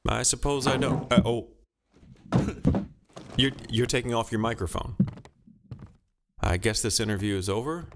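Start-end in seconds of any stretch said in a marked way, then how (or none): none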